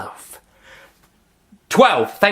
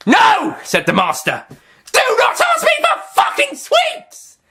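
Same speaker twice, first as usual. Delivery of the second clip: furious shriek; 4 dB louder than usual. Acted. Master furious refusal.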